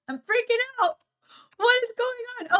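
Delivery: high-pitched, hammed-up freak-out; mock panic. Streamer losing control of her emote effects, mock panic. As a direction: pitch leaps up in playful panic.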